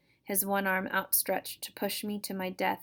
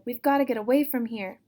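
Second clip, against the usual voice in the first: louder, higher urgent plea; 4 semitones higher, 7 dB louder than usual. Acted. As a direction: press her to run now. Brother urges sister to flee.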